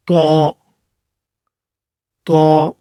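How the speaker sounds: طا thick and hard, a heavy t; heavy Arabic ta. Shown as a demonstration. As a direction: punch a hard, full طا. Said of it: "موٹے اور سخت طریقے سے پڑھیں گے".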